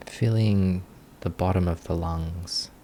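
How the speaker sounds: ordinary talk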